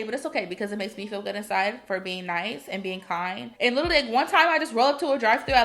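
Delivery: normal talking voice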